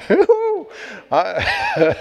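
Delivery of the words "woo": high whoop with a laugh; laugh, then let out a high "woo"; delighted, high laughing whoop. Real, not acted. Preacher overcome, laughs and whoops.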